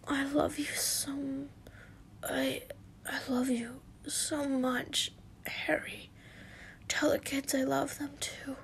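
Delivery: breathless whisper of a dying man; weak dying whisper. Acted. Dying husband whispering farewell to Harry, faint whisper. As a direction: fade out in a frail whisper. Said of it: "he said weakly".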